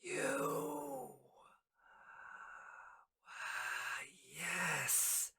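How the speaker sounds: hushed, whispery, drawn-out villain voice; alchemist villain. Acted. Alchemist recognizes Bryn, slow hushed menace. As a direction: breathe it out very softly, with long pauses. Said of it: "He's like".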